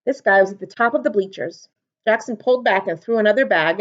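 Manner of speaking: ordinary delivery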